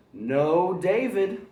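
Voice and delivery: put-on a mother telling her boy no; scolding mother calling out; mom scolding. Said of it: "David's mom always said".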